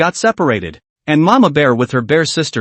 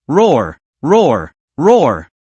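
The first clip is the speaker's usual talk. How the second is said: angry roars; much slower than usual. A narrator's reading. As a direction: roar out in pain and rage. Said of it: "roared in anger".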